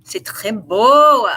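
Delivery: slow, savoured French exclamations; playful French. Pretend. Reader slips into French over Cancer's cards, drawn-out French. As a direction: gush in French, drawing the words out. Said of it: "I don't know why I'm speaking French".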